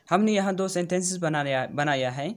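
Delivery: ordinary talk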